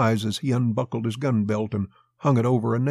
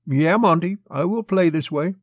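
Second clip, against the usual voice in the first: higher-pitched, rising plea; earnest appeal; 7 semitones higher, 4 dB louder than usual. A narrator's reading. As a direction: raise the pitch and appeal to him. Girl pleads with Monty.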